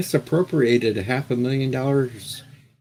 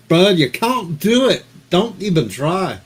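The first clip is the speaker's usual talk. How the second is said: high, loud hammed-up Liverpool accent, slow; 8 semitones higher, 8 dB louder than usual. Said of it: "If that wasn't [name]".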